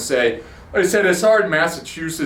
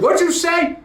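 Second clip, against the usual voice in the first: high, sharp challenge shouted back; mock yell; 10 semitones higher than usual. Confronting the cyclist at the red light, a mock yell. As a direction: retell yelling at the cyclist. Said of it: "And I yell at him".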